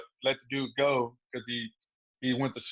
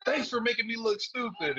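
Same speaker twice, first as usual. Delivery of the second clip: higher-pitched mock complaint; aggrieved announcer; 9 semitones higher than usual. Fighter retelling what the announcer told him, mock complaint. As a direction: imitate his complaint in a raised voice. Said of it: "he was like".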